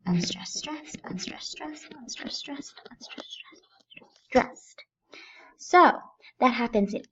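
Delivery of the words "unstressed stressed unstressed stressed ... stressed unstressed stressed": soft chant of unstressed, stressed in even pairs; alternating stress. Shown as a demonstration. Teacher reciting each line's pattern, hushed alternating rhythm. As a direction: tick through unstressed, stressed under the breath, fading. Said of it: "Each line goes".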